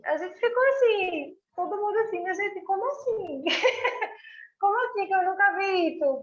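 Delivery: high, excited disbelief with laughter; gushing amazement over a surprise gift; amazed. Retold or imitated. Guest retelling the box of pine cones, high amazed gush.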